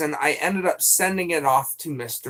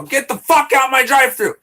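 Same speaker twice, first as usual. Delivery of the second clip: loud, higher-pitched shout; mock angry yell; 7 semitones higher, 7 dB louder than usual. Streamer recalls his Jack in the Box outburst, angry yell. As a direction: yell it like ordering someone off. Quoting an old drive-thru outburst.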